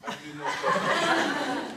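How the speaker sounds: stretched out with a laugh; laughing strain. Real, not acted. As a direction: laugh through the move. Demonstrating the pose.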